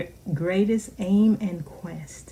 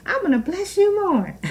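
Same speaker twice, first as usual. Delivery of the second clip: much higher, quick playful voice of God; voicing God; 13 semitones higher than usual. Preacher voicing God's reply to her, high and playful. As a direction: answer as God, delighted to outgive. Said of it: "he said".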